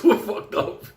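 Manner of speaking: high, loud, laughing lament. Real, not acted. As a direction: laugh helplessly through the words. Laughing at their predicament.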